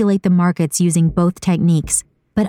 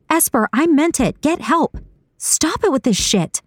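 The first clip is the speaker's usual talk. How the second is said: high-pitched, fed-up demand; exasperated high character voice; exasperated insistence; 9 semitones higher than usual. A narrator's reading. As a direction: insist firmly without shouting, pitch up. Saga insists he get help.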